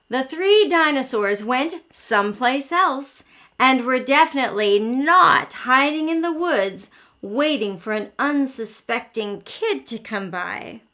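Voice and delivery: plain reading voice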